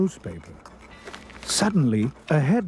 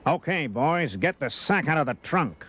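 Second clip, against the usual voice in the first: shouting rat boss; 2 semitones higher than usual. A narrator's reading. Huge rat boss to his gang, mock shout. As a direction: bark orders round a cigar. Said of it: "shouted a huge rat chomping a cigar".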